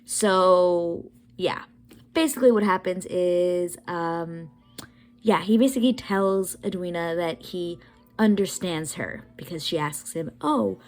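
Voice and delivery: upbeat voiceover catching viewers up; voiceover recap. Real, not acted.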